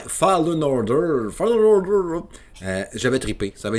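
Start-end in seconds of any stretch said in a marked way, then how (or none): 0.1-1.3 mock English pronunciation. Correcting his accent for his friend [name], a hammed-up slow English accent. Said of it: "accent de merde quand c'est le temps de dire". 1.3-2.2 mock English pronunciation. Showing off his accent to his friend [name], a hammed-up high, loud English accent. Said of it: "accent de merde quand c'est le temps de dire".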